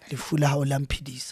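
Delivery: hushed, sly whisper of a biting line; malicious whisper. Acted. Guest whispers a spiteful Sesotho line to the host, hushed and sly. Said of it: "deliberately and malicious here".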